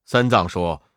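ordinary delivery, nothing marked

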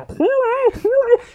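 high, drawn-out repeated calls; summoning call. Retold or imitated. Monkey King shouting again and again for his head, high calls. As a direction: call the head home, stretching each cry. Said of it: "孙悟空喊了好几遍".

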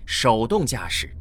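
ordinary talk

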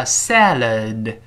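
salad drawn out slowly, both syllables full; slow teaching model of salad; slow reading. Shown as a demonstration. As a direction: say "salad" slowly with the final d sounded. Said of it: "读慢了叫salad".

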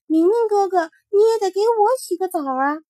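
a small rabbit gently asking for a bath; little rabbit voice. Acted. Rabbit asking sleeping Mingming for a bath, high and pleading.

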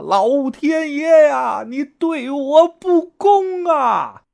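very high, long-drawn anguished cry; howl to heaven. A narrator's reading. Rejected man kneeling in the street, howling at heaven. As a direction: stretch each word into a despairing howl. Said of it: "仰天长啸".

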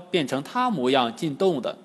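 ordinary delivery, nothing marked

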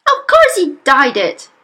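loud, high exasperation; mother crying out. Acted. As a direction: cry it out accusingly. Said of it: "the mother cried".

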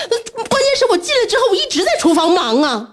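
high, hurried mock protest; retold yelling. Mother re-enacting yelling at her son, high exasperated protest. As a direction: plead your case, high and exasperated. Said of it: "就跟他喊".

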